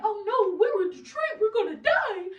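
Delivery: high, loud, laughing fake terror; mock panic. Sitcom kids dreading Detroit, hammed-up high laughing panic. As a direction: ham up the fear of Detroit with a laugh. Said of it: "they used to always talk about, like".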